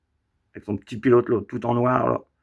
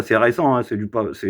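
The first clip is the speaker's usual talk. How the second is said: normal talking voice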